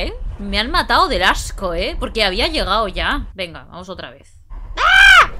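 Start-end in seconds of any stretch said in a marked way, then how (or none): 4.8-5.3 high, loud scream; grito de susto. Real, not acted. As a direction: scream as the ghost appears. Jump scare in horror game. (chillido fuerte de terror)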